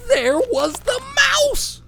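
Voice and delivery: high, loud, slowed cry of dismay; shocked outcry. A narrator's reading. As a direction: wail it out in horror. Bear finds mouse again.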